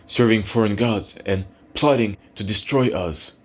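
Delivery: high, loud frantic justification; desperate pleading. Acted. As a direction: talk fast to save your skin. Cornered soldier pleads for life.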